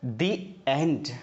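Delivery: "the end" as dee, pause, and. Shown as a demonstration. Teacher giving the answer, "the end" as a separated dee and. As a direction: say "the" in "the end" with long ee, words apart. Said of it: "तो क्या बोलेंगे इसे?".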